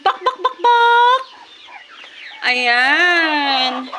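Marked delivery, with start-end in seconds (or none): 0.1-1.4 high clucking bak bak bak, last one louder; high chicken-call clucks. Real, not acted. Vlogger calling her chickens to feed with clucks.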